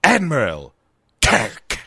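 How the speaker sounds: mock-villain summons, punched hard; two weighty words, drawn apart for menace; Khan. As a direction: play a hammy movie villain calling out his nemesis. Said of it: "He is just Khan".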